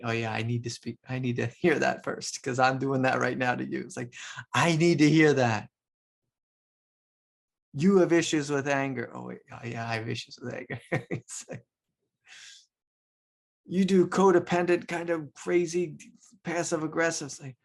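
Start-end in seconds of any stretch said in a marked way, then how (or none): 4.5-9.1 loud mock accusation. Imitating an accuser, a loud mock accusation. 13.7-17.3 higher, louder mock accusation; mock accuser. Imitating an accuser, a higher, louder mock jab.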